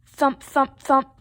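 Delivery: loud, evenly punched thuds; punchy storybook effect; thumping sound words. A narrator's reading. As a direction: hit each word like a ball on a wall. Ball hitting the wall.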